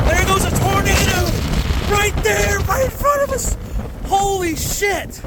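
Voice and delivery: high, breathless excitement; panicking. Real, not acted. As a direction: shout it out in real awe. Storm chaser sees tornado close.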